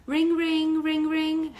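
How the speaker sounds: loud, high sing-song ringing; phone ring. Acted. Narrator making the cell phone ring, loud and high.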